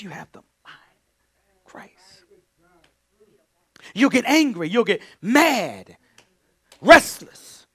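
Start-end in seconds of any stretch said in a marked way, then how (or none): 3.9-7.7 loud, high fervent preaching. Real, not acted. Preacher warning the church, fervent and loud. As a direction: hammer each word out.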